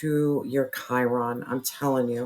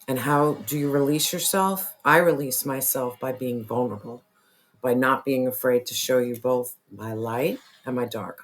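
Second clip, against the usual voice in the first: ordinary talk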